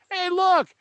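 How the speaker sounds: high, loud and gleeful alien discovering junk; friendly alien. Pretend. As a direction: squeak it out like a cheerful little alien who just found a cable. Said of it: "guy's gonna walk up and say".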